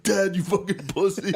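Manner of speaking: put-on high, wailing teenage taunt; mock tearful outburst. Drunk shirtless son challenging dad, mock tearful outburst. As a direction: hurl it like a sobbing kid, laughing through it. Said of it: "I was sobbing".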